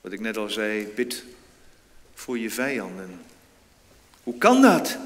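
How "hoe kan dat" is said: loud, high outcry; exclaiming. Retold or imitated. Someone crying out in disbelief, loud and high. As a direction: cry it out in disbelief. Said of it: "riep iemand uit".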